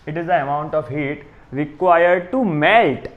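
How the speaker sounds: slow, measured read-back; slow dictation. Real, not acted. Teacher repeats the definition for students writing, slow dictation. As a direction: read it out slowly for note-takers.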